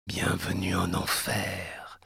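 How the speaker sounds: soft, slow, sinister whisper; sugary whisper. Acted. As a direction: breathe it into her ear. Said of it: "d'une voix doucereuse".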